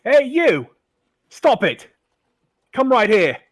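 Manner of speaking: very loud, high shout; woman guard calling out to stop them, shouting; yelling. Acted. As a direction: bellow it across the street. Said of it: "yells out".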